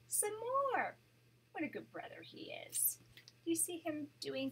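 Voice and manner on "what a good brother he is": usual speaking voice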